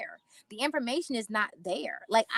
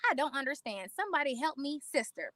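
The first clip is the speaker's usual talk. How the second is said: ordinary talk, nothing marked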